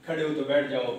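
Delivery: ordinary talk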